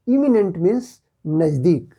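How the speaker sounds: normal talking voice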